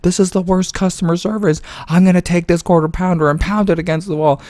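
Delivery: high squealing mock fury; screechy mock tantrum; mock outrage. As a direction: ham up a customer losing it. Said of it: "she goes".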